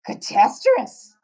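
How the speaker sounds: loud, slow, stretched giant cry; dismayed cry. Acted. Giant horrified by upward bubbles, slow loud cry. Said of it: "cried the B F G".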